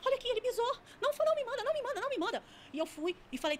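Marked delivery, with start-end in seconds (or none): 0.0-2.4 put-on very high, frantic childlike begging; child's voice. Demon begging not to be sent away, high child voice. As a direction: imitate a panicked child pleading over and over. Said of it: "com uma voz de criança".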